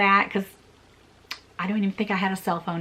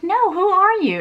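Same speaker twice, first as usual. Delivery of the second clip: higher and louder, confused; puzzled question; 10 semitones higher, 5 dB louder than usual. Retold or imitated. Asking the stranger at the door, confused and surprised. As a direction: demand to know who she is. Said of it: "And I said".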